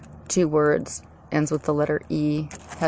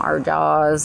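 normal talking voice

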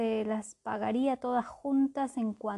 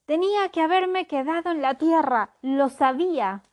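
panicked sailor; 6 semitones higher, 7 dB louder than usual. Acted.

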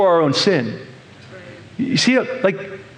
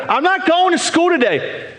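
put-on higher sulky child refusing school; whiny kid; 9 semitones higher than usual. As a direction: pout it out like a stubborn kid.

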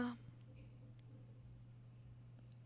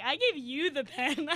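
indignant; 3 semitones higher, 5 dB louder, slower than usual. Retold or imitated. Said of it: "I was like".

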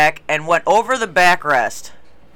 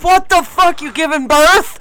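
shocked disgust; 7 semitones higher than usual. Retold or imitated. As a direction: blurt it out, appalled at the noise next door. Disgusted reaction in bathroom stall.

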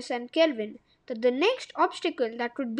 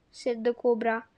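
ordinary delivery, nothing marked

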